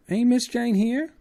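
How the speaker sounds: very high, loud alarmed cry; suddenly frightened woman; high wailing maid voice. Acted. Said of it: "cried Esmeralda".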